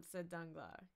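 ordinary delivery

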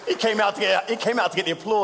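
high, laughing voice; amused protest. Real, not acted. Presenter joking that colleague came for applause, laughing.

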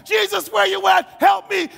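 high, loud desperate plea; freaking out. Retold or imitated. Preacher as believer with bad news, shouting desperately for help. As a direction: cry out in panic. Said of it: "I'm freaking out".

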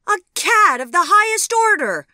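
loud, high denunciation; high-pitched, scandalised outburst; indignant cry. A narrator's reading. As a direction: cry out the insult at full force. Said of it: "cried Vivian".

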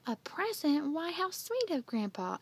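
high, lilting delighted question; pleased surprise. A narrator's reading. Aunt hearing Grandpa sent a present, pleased surprise. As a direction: coo with pleasure at the gift. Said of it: "Aunt Minnie looked pleased".

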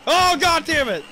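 high, loud furious outburst; frustrated yell. Real, not acted. Gamer blows up at a game setback, yelling. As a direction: let it explode.

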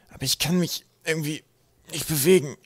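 high, effortful broken phrases; straining gasp. A narrator's reading. Twig cannot move in the net, straining gasp. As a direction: strain against the net between words. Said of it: "keuchte Twig".

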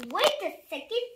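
plain reading voice

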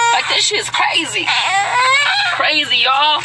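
real tears breaking through a shout; crying. Real, not acted. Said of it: "and I ain't crying no more".

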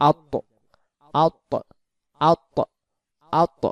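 আত repeated with the lips rounded for the heavy ত; rounded-mouth drill. Shown as a demonstration. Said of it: "মুখটা গো-, গোল হয়ে যাবে". (গোল মুখে আত উচ্চারণ)

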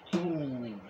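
ordinary talk, nothing marked

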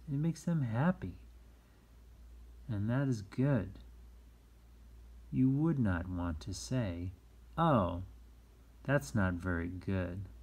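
ordinary delivery, nothing marked

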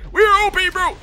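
high-pitched excited crowing; exultant boast. Real, not acted. Gamer bragging about the match, exultant. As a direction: crow it to your teammates.

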